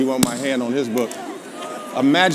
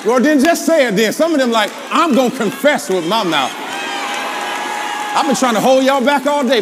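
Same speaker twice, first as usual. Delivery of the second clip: high, loud preacher's outburst; loud preaching burst; 8 semitones higher, 5 dB louder than usual. Real, not acted. Preacher unable to hold back the congregation, loud burst. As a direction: let it burst out of you.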